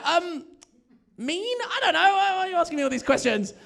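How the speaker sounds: high-pitched mock panic, ending in a rush; stall, then protest in a high fluster. Boyfriend asked what facetious means, flustered and defensive.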